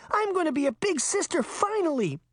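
put-on very high little-girl crow of delight; gleeful crowing. A narrator's reading. Little sister hears a baby is coming, crowing with delight. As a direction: crow it high, held up right to the end. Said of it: "Daphne crowed".

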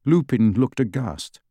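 ordinary delivery, nothing marked

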